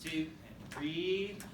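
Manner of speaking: slow, rhythmic count-in on the beat; steady band-teacher count-off. Shown as a demonstration. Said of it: "Everybody do letter D".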